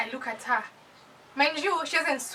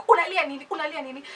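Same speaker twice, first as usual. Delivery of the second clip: higher-pitched mock scolding; imitated scolding shout; 3 semitones higher, 4 dB louder, faster than usual. Mother demanding why her child cries, mock scolding. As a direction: scold like an African mother, mildly raised voice. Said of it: "then she shouted at me".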